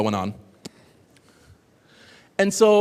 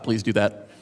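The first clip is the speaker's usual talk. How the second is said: normal talking voice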